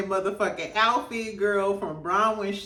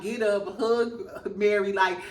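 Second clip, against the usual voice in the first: normal talking voice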